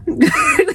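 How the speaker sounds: a high burst of laughter; real laughter. Real, not acted. Laughing at the Pepe Le Pew story.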